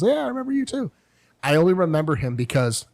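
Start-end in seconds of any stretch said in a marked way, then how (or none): 0.0-0.9 cheerful reply. Retold or imitated. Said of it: "he goes".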